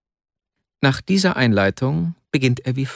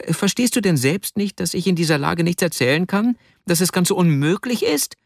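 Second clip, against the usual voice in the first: weakling's cry; 7 semitones higher than usual. A narrator's reading. Weak Vasja struggling in his friend's grip, high cry of protest. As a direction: protest helplessly in a raised pitch. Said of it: "schrie der schwächliche Vasja".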